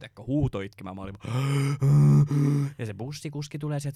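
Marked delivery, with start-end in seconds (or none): 1.8-2.7 loud sharp gasp of pain. Retold or imitated. Hurt on the bus floor, a loud pained gasp. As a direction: gasp out loud as the wrist snaps.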